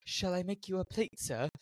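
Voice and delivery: hushed, badly guessed accent for Smee; botched Smee accent. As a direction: offer it meekly in a wrong Smee accent. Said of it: "that's not the right accent for Smee".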